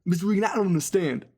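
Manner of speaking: flustered meathead in weird mode; Brandon. Retold or imitated. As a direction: imitate the flustered jock's odd voice. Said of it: "he just went into, like, weird mode".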